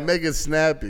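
ordinary talk, nothing marked

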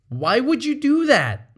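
sharp, high accusing "would"; accusatory question. Shown as a demonstration. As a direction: accuse them outright. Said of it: "One sounds a little more accusatory".

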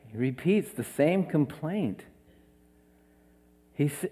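normal talking voice

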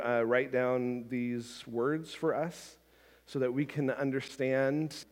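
soft, earnest pastoral prayer voice. Real, not acted. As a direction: pray quietly for the congregation. Leads a prayer.

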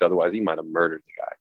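usual speaking voice

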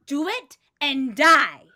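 loud, slow threat; forceful drawn-out warning; menacing threat. Acted. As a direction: hit each word hard as a warning. Threatens the one tattling.

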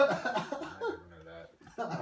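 slow, laughing and high. Real, not acted. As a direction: laugh out the words. Says it laughing.

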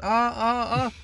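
high-pitched mock cries of "ah"; short repeated high yelps, play-acted; imitated pained screams. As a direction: mimic an injured friend crying out. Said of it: "Marin schreit".

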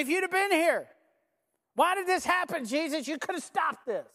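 high-pitched, upset protest; pleading reproach. Acted. Grieving sister confronts Jesus, pleading reproach.

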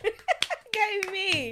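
high, loud, laughing cheer; gleeful high whoop for yourself; self-cheer. Real, not acted. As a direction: cheer yourself on at full pitch. Cheering herself on after Wow.

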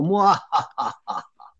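mwahaha evil cackle; villain laugh. Pretend. Mwahaha after praising capitalism.

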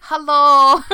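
loud, high mock Dutch hello; hammed-up Dutch accent greeting. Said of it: "how do Dutch people talk?".

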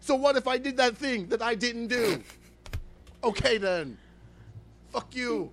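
mocking quote. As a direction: throw his words back in a put-on voice. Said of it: "So you just say".

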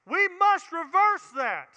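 "must" punched slowly in a shout; emphatic repeat. Acted. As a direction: pound on "must".

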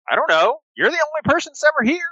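high, sweet toy-animal voice; childlike plush friend. Acted. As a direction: speak like an eager stuffed elephant bear. GM voices Billy Bucks.

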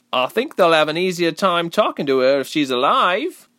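high, loud comeback; sharp retort. A narrator's reading. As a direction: shoot back at the objector. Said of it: "the girl shot back".

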